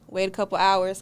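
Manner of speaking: ordinary talk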